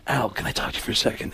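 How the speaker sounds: hushed, low and fast approach; Alec Baldwin. Acted. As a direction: ask discreetly under your breath. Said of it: "and Alec goes".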